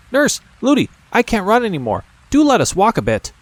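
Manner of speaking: put-on high pleading little girl; a tired child begging to walk; princess begging. A narrator's reading.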